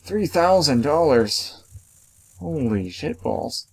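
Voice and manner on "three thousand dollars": stunned repeat. Real, not acted. Repeating the shocking price.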